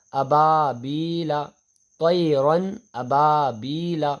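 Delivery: slow syllable-by-syllable Quran spelling drill; teacher spelling out letters for pupils, slow and deliberate. Shown as a demonstration. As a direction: spell each letter and vowel slowly, then join them. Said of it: "اس کی میں ہجے لگا کر آپ کو پہلے پڑھاتا ہوں".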